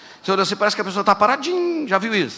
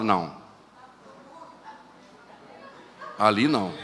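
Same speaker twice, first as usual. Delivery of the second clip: dismissive no; 9 semitones lower, 7 dB quieter than usual. Retold or imitated. Judging a lifeless believer.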